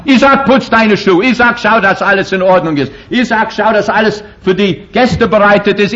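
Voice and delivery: high-pitched mock orders from the wife; bossy wife. Preacher imitating a wife ordering her husband, high and bossy. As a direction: play the wife bossing Isaac around.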